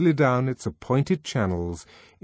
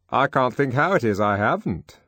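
other man; 5 semitones higher, 5 dB louder than usual. Acted. Colleague replying to Henry.

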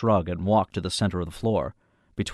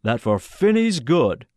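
slow, raised, firmly measured voice; authoritative, controlled; 5 semitones higher, 5 dB louder, slower than usual. A narrator's reading. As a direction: state it slowly and loudly, with authority. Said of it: "full of authority and perfectly under control".

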